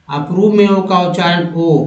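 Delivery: ordinary talk, nothing marked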